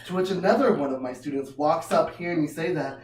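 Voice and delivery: ordinary talk, nothing marked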